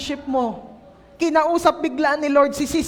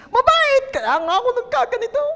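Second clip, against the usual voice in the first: exaggerated exclaim; 12 semitones higher than usual. Sister answering God with a high mock cry. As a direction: squeal it like a flustered sister. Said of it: "Hindi ba mabait si Pitts?".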